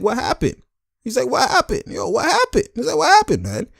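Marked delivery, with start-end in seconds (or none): 0.0-0.6 much higher, puzzled coach voice; a baffled coach squeaking out the question. Retold or imitated. Coach arriving at the huddle, asking high and bewildered. 1.3-2.7 very high, strained bewildered voice. Retold or imitated. Coach pressing his players, squeaking high and baffled. 3.0-3.7 very high, strained bewildered voice. Retold or imitated. Coach pressing his players, squeaking high and baffled.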